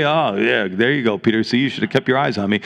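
low-pitched, offhand mock scolding; mock reproach. Preacher voicing what Jesus didn't say to Peter, mock reproach. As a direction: imitate a casual told-you-so. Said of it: "Jesus didn't say".

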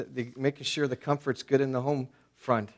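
ordinary delivery

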